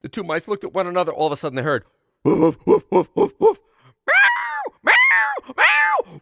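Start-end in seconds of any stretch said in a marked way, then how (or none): 2.2-3.6 loud barking woofs; dog barking. Acted. Dog chasing the cat. 4.1-6.2 very high, loud shouted meows; screeching cat. Acted. Cat fighting the dog.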